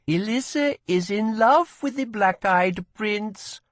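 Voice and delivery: high, slow, teasing gossip; teasing girl. A narrator's reading. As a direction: tease her friend about her crush. Girl teases friend.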